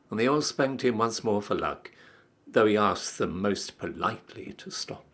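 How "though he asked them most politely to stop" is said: ordinary delivery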